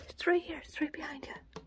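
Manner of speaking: soft coaxing whisper. Real, not acted. Owner whispering to her rat where the apple is. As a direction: whisper the hint gently.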